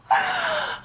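high, crying little laugh; teary laugh. Real, not acted. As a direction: laugh through sudden tears. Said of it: "I'm gonna get emotional".

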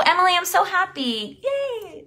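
high, soft delighted squeal; real happiness. Real, not acted. Coach thanking a viewer during her live, really happy. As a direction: coo it happily, pitch climbing into a small yay. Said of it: "This makes me so happy".

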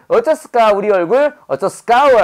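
light Jeolla lilt, 어쩌스까 falling; mock Jeolla dialect pun. Teacher repeats pun in Jeolla dialect. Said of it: "전라도 말로 말하는 거예요".

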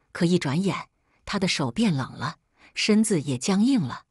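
usual speaking voice

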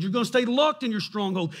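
ordinary delivery

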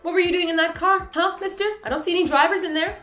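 high-pitched playful interrogation; mock scolding. Asking G D what he did in that car, high-pitched mock scolding. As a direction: question him like a child caught out.

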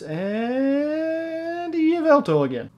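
long, high rising "and" before the name; slow, high-pitched build to the reveal; drawn-out card reveal. Real, not acted. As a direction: stretch the lead-in up high, then drop.

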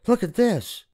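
put-on high, eager boy voice; excited boy. A narrator's reading. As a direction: show off the treasure. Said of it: "Jack called".

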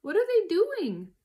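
high, loud little fox question; crying out. A narrator's reading. Small foxes asking their dad, high worried cry. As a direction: pitch it up like an alarmed child. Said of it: "cried the small foxes".

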